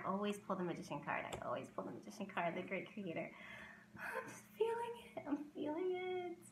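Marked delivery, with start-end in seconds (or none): 4.0-6.4 high, tiny choked-up voice; soft, high-pitched, close to tears; having a moment. Real, not acted. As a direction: squeak it out, overcome with emotion. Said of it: "I'm really having a moment".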